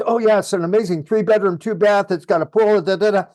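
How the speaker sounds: rapid-fire, eager sales pitch; talking too fast. Shown as a demonstration. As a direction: rattle off the listing details quickly. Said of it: "you're talking really fast".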